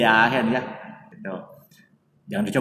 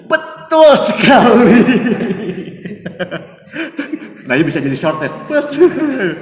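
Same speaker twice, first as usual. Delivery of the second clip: loud, high-pitched burst of laughter on the words; laughing agreement; 11 semitones higher, 11 dB louder than usual. Real, not acted. Agreeing with the co-host's guess about no teacher, loud laughing outburst. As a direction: crow it out loudly, breaking into laughter.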